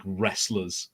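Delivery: ordinary talk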